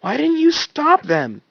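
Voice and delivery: demanding. Acted. Wash demands answers from Tucker, angry. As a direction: demand it, jabbing the question. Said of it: "he demanded from Tucker".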